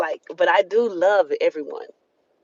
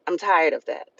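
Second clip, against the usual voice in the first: ordinary talk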